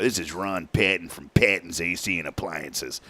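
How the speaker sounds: low, soft imitation of a business owner; Ron Patton's talk. Customer introducing himself and his appliance business, imitated low and soft. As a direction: drop low and quiet to mimic the customer. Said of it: "That's how he talked".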